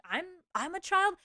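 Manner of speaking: mock-baffled question; incredulous echo. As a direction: repeat the insult back, astonished. Retelling amused disbelief at insult.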